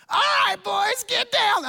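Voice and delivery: hammed-up motivational speaker yell, very high and strained; loud, high-pitched drill-style shouting; Matt Foley. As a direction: bellow at the boys like an over-the-top coach. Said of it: "I'm doing my best Matt Foley imitation".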